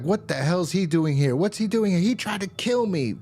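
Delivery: high-pitched imitation of an outraged protest; mock outraged screaming. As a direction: act out indignant bickering, pitch climbing. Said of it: "everyone's bickering and screaming at each other".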